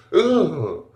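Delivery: loud, high "ugh"; mock disgusted groan. Reviewer acting out the blockage removal, mock disgusted.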